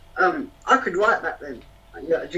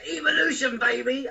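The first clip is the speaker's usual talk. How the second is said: lightly voiced little alien, higher; alien movie quote; 7 semitones higher than usual. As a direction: quote the alien with a bit of flair. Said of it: "the little alien goes".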